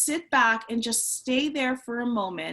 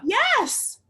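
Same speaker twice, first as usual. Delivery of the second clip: delighted, high exclamation; excited yes; 5 semitones higher, slower than usual. Real, not acted. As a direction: cheer her answer on. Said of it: "excited reaction to answer".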